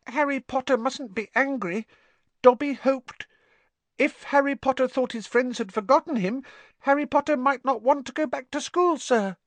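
high, worried elf voice; fretful squeaky little elf; anxious house-elf. Acted. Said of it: "Dobby blinked anxiously".

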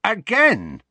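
high, loud and slowed, near a shout; almost shouting. A narrator's reading. Ron listing Harry's feats, almost shouting. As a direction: drive it home, nearly yelling. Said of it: "almost shouting now".

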